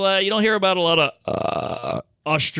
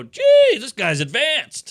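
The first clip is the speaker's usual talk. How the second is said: "Geez" yelped very high and loud, then amazed; a gobsmacked crowd member marvelling at old jokes; amazed Aussie; 7 semitones higher, 6 dB louder, slower than usual. Retold or imitated. Australian audience gasping in amazement at a comic. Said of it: "they go".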